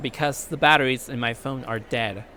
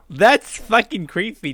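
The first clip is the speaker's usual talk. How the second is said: laughing disgust; 6 semitones higher, 6 dB louder than usual. Real, not acted. Laughing at creepy face.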